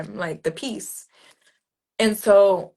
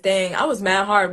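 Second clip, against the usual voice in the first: normal talking voice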